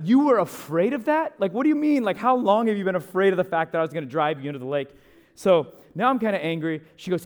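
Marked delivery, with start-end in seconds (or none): 0.0-4.9 loud, high, incredulous mock outrage; retold indignant outburst, pitch leaping at the start; mad. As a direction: throw it back at the passenger, appalled. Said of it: "at this point, I'm mad".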